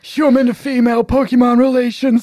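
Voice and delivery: high put-on voice, dragging out the end; John. Mimicking absent player's random internet rants, high mock-ranting voice. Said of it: "That's what he would say".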